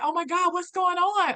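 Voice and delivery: alarmed facilitator. Retold or imitated. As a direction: play the startled coach seeing her break down. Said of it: "the facilitator was like".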